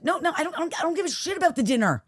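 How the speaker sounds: high, agitated mock protest; retold annoyance. Wife imitating husband dismissing dinner, retold annoyance.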